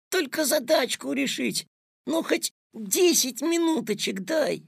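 put-on high boyish wheedling; whining plea. A narrator's reading. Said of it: "законючил Петя".

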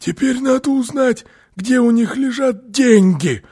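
strained, very high, hoarse crook voice; Phille's hoarse whisper. A narrator's reading. Burglar asks his partner about the money, hoarse and strained. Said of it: "сказал Филли хриплым шёпотом".

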